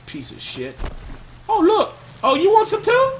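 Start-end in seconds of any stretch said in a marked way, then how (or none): 1.5-3.1 much higher mock-surprised taunt. Real, not acted. Gamer baiting a new enemy, high mocking taunt. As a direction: bait the enemy in a high sing-song.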